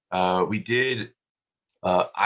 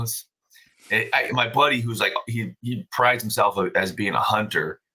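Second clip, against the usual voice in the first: ordinary talk, nothing marked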